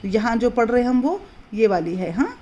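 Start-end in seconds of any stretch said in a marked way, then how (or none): none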